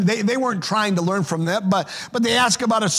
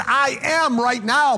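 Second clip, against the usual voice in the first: "I am" pressed slowly and raised high; stress on I AM; 4 semitones higher, slower than usual. Shown as a demonstration. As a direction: lean hard on "I am", take it slow. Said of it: "present tense".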